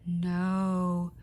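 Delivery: usual speaking voice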